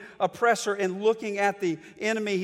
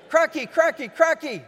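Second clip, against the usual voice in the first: put-on high, loud, excited baby voice; imitating his daughter demanding crackers; toddler yelling; 8 semitones higher, 6 dB louder than usual. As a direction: squeal it like a greedy one-year-old. Repeats toddler's yell.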